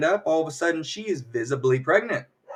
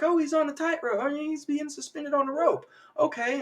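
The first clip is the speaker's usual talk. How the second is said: much higher, thin put-on voice; high-pitched crowd voice; 13 semitones higher than usual. Audience explains away the levitating magician, high mimicking voice.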